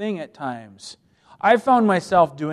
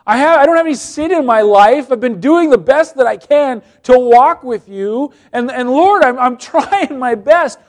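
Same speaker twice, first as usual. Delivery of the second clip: much higher, louder self-pitying complaint, fading at the end; self-pitying whine; 7 semitones higher, 10 dB louder than usual. Acted. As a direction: whine to God like a wronged child. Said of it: "feeling sorry for myself".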